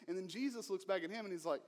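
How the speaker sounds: normal talking voice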